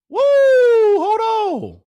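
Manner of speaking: high, loud, drawn-out whoop; stretched high holler of excitement; excited whoop. Real, not acted. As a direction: whoop it out in amazement.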